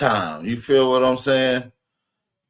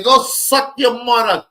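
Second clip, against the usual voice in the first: put-on higher, slow Jamaican-accented insult; imitating a Jamaican comeback, higher and slow; mock Jamaican accent; 7 semitones higher, 3 dB louder, slower than usual. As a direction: play the angry Jamaican tailor, drawn out. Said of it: "When they say".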